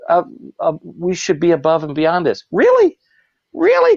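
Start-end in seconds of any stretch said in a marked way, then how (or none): 2.5-4.0 high, loud mock disbelief; Really, really, squeaked high in disbelief; incredulous. Real, not acted. Mocking the clown groups with loud incredulity.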